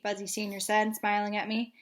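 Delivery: plain reading voice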